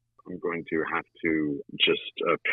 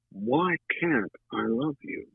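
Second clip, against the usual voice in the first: slow, pitched-up plea; slow pleading question; 6 semitones higher, slower than usual. Retold or imitated. Big Mama begging Truman to explain, slow and pitched up. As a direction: ask it slowly, pleading.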